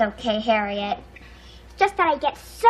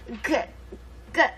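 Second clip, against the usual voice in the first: stuttering on the g sound; 3 semitones lower, 8 dB quieter, much faster than usual. Acted. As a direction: get stuck on the first sound. Stuttering girl reads word list.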